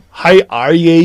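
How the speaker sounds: mock Irish greeting; Irish accent impression. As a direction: put on an Irish lilt. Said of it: "the Irish is like".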